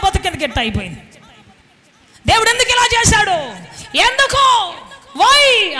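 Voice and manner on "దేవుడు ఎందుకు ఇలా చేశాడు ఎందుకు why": high, drawn-out mock wail of grievance; acted complaint. Preacher mimics someone grumbling at God, high plaintive complaint. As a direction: imitate a grumbler demanding answers, pitch climbing. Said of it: "ఏడుస్తూ ఈ రోజున కూర్చొని సణుక్కుంటూ".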